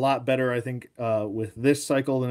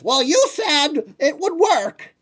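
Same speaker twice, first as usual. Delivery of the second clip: mock whiny complaint; 13 semitones higher, 4 dB louder, slower than usual. Mimicking people who misread probably.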